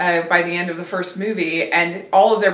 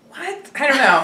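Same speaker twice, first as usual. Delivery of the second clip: high, baffled laughing what; 6 semitones higher than usual. Real, not acted. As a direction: squeak it out in disbelief. Baffled at Hasselhoff cameo.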